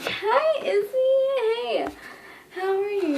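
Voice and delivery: very high, loud singsong hello; gushing mock greeting. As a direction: imitate an over-eager greeting. Imitating boy finally greeting her.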